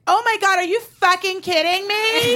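high-pitched, indignant outburst; acted outrage. Girlfriends round on a drunk man, high outraged disbelief. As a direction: explode in disbelief on a friend's behalf.

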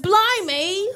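mock British. Shown as a demonstration. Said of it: "Spice Girls".

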